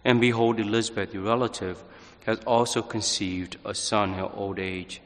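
measured, solemn scripture reading; gospel reading. Acted. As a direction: proclaim the Gospel calmly and steadily. Priest proclaims the Gospel reading.

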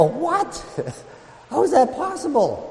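high-pitched startled what, with a laugh; surprised disbelief. Retold or imitated. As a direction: play up the shock, laughing in disbelief. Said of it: "I go".

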